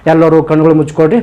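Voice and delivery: ordinary delivery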